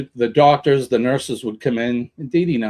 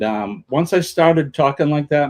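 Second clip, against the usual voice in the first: ordinary talk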